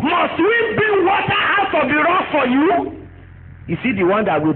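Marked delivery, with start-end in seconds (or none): 0.0-3.1 higher pitched, slow, indignant shout; angry prophet. Retold or imitated. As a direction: hurl the question at them in fury. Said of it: "when you are angry, you'll be talking nonsense".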